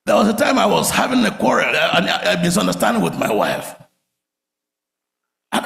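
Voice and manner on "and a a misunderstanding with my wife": usual speaking voice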